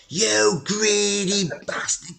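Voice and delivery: hammed-up furious chairman losing it on the phone; retold furious outburst. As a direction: stretch the insult out high and slow, mock rage. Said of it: "he just went mental".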